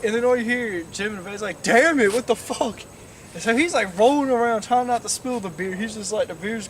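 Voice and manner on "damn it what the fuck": loud burst on the first words; imitated angry outburst. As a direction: swear like a jolted passenger. Quotes jolted passenger swearing.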